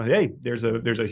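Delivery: normal talking voice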